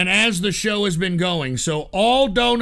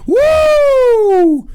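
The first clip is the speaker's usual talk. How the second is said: high whoop; 12 semitones higher, 7 dB louder than usual. Real, not acted. Cheering the donations.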